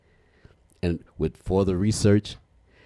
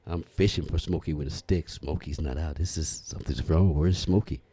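normal talking voice

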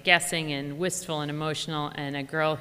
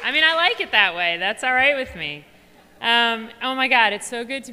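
excited; 10 semitones higher, 10 dB louder than usual. Real, not acted. Speaker greets cheering crowd.

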